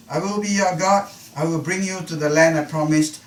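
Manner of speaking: God pledging, voice lifted; emphatic promises. Acted. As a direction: proclaim each promise firmly. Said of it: "very concretely, very specifically".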